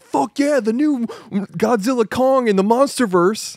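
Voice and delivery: high, mock-enthused fanboy delivery; imitated hype. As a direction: act out being totally stoked. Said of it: "That's kinda how he said it".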